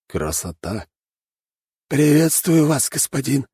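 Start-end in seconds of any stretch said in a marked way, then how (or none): 1.9-3.5 high, slow, polite rat voice; a courteous little samurai rat; polite rat servant. A narrator's reading. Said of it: "вежливо поприветствовал меня Один".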